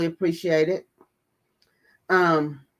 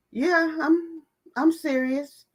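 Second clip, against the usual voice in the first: high, pointed yes I'm serious; mock-earnest reply; 11 semitones higher, slower than usual. Retelling her reply to the therapist, high and pointed. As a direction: answer back with mock sincerity. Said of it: "I said".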